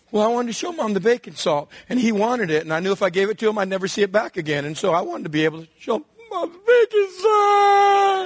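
rising to a high wailing sob; mock crying girl. Eleven-year-old crying to dad about the salt, wailing. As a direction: wail it out, breaking down.

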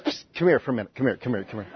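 hushed, low, repeated beckoning; confidential aside. Acted. Peter discreetly pulling Jesus aside, hushed and low.

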